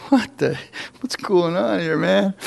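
bewildered. Retold or imitated. Retelling his bewildered reaction.

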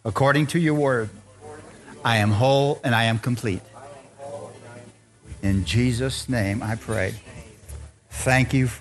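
low, measured congregational prayer; leading prayer. Real, not acted. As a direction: pray in short phrases with long pauses.

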